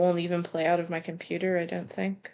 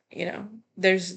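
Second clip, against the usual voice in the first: ordinary talk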